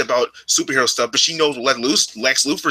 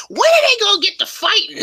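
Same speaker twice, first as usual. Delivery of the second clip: squeaky high imitation of his mom, laughing through it; 3 dB louder than usual. Son mimicking his mom's complaint in a squeaky, laughing voice. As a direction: pitch way up and crack into a laugh. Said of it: "It's like".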